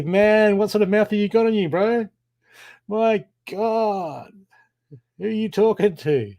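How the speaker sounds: mock shock. Mock shock at rude mouth.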